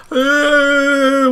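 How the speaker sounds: high-pitched imitated sobbing wail; mock crying wail. Imitating a friend crying on the phone, mock wail. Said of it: "and then you hear crying".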